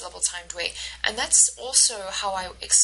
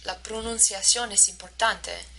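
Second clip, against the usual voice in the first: "La pronunciación es importante" with equal stress throughout; syllable-timed Spanish rhythm. Shown as a demonstration. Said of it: "has the same stress".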